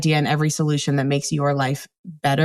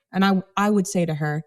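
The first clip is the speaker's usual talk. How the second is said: normal talking voice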